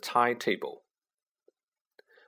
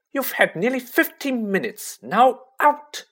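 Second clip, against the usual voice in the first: high, raised voice, no arguing; firm; 11 semitones higher, 5 dB louder than usual. A narrator's reading. Nurse sends visitors out of sickroom, firm. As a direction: firmly order visitors out, pitched high. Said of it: "she said firmly".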